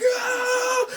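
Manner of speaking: high mock scream; acted high wail of nerves; panicked scream. Sweating bidder losing it, mock panicked scream. Re-enacting rising adrenaline.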